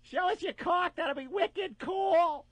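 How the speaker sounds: put-on high, slow Boston girl; Boston accent. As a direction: wheedle it in a thick Boston accent. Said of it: "this stupid Boston accent".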